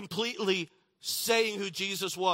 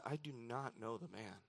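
much softer, low denial; quiet denial; 6 semitones lower, 13 dB quieter than usual. Acted. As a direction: drop low and hush it like a guilty denial. Peter's third denial.

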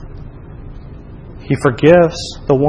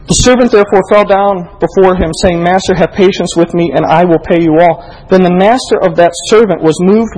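scripture reading; 3 semitones higher than usual. Acted. Preacher to congregation, reading the parable aloud. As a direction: proclaim the text with force.

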